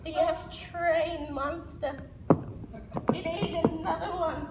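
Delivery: put-on slow, eerie old-woman voice; old lady. Old lady on the subway reveals the monster, eerie. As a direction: draw the words out slowly like an ominous crone. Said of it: "And then she's like".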